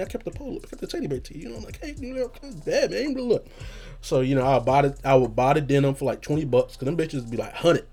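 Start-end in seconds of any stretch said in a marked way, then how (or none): 0.0-3.5 high, softer excited gush. Real, not acted. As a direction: rave about the clothes with pitch up. Excited about his keepsakes.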